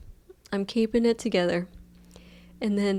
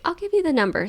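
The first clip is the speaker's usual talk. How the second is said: high lady voice; 9 semitones higher, 3 dB louder, faster than usual. Retold or imitated. Voicing the lady.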